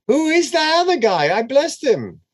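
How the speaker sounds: put-on high, rattled cry of a shaken old man; panicked Isaac. Isaac to Esau realizing he blessed another, high panicked. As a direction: let the pitch jump up in dismay.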